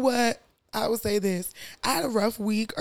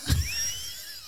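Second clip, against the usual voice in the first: very high squealing laugh; high, helpless cackling fit; real laughter; 5 dB quieter than usual. Real, not acted. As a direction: lose it completely. Cracking up at Barcelona lisp.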